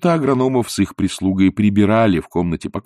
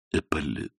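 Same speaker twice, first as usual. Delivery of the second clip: faint repeated name; 4 semitones lower, 10 dB quieter than usual. A narrator's reading. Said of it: "повторила теща".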